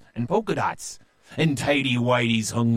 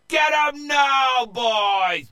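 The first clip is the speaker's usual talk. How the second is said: high, full-voiced shout; pirate captain hollering the attack; battle cry; 6 dB louder, slower than usual. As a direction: send the men charging in. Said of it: "Joe cried out".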